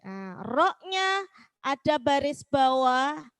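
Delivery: ordinary talk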